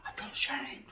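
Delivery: I feel ashamed, whispered with no voice; whispered repeat. Shown as a demonstration. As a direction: say it again, this time in a whisper.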